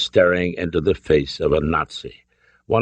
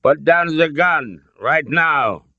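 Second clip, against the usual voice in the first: put-on slow, high order in a German accent; thick German accent; 9 semitones higher, 4 dB louder, slower than usual. A narrator's reading. Colonel orders the gun down, thick German accent. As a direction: command with a heavy German accent. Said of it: "his thick accent".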